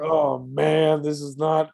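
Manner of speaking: embarrassed dismay. Retold or imitated. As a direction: replay an embarrassed "oh man" moment. Retelling his embarrassment at the premiere.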